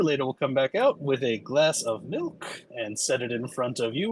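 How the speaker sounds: ordinary delivery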